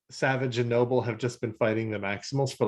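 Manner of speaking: usual speaking voice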